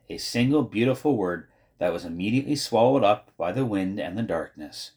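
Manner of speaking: usual speaking voice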